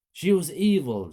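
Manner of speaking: high, loud spiteful snap; vicious. A narrator's reading. Mother to her kids, spiteful. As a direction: spit the words out.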